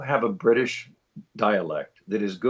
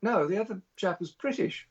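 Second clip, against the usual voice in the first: high, soft British woman correcting someone; J K Rowling; 7 semitones higher, 5 dB quieter than usual. Retold or imitated. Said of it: "And she was like".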